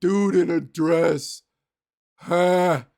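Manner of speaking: a lightly voiced lunk delivering a tired gag; dumb guy's joke. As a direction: play a clueless dude laughing at his own joke. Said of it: "some guy's like".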